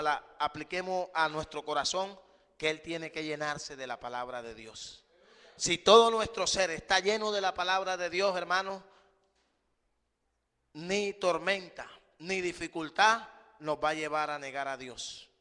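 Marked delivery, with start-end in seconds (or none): 5.6-15.3 impassioned preacher's build; fervent preaching. Acted. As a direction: preach it with rising fire. Preaching at full force.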